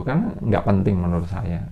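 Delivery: ordinary talk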